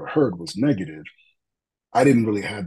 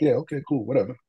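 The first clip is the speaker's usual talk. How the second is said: dismissive brush-off; 5 semitones higher, faster than usual. Retold or imitated. Busy cop brushing off partner.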